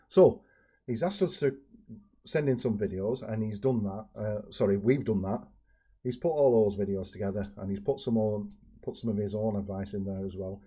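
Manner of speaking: ordinary talk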